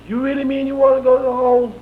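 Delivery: high, loud, incredulous sheriff; imitating Sheriff Tyson. Sheriff asks prisoner about going to the hole, high loud imitation. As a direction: mimic his disbelief way up high. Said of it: "And so he said".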